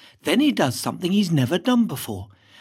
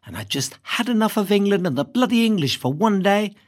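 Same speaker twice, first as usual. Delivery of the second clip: usual speaking voice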